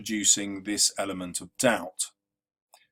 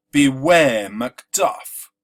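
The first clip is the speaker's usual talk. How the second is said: Beware Macduff with the weight on -ware and -duff; slow and louder, tapping out Beware Macduff as da-DUM da-DUM; iambic stress; 2 semitones higher, 8 dB louder, much slower than usual. Shown as a demonstration. Teacher tapping out the stresses of Beware Macduff, slowly. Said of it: "is unstressed, stressed, unstressed, stressed".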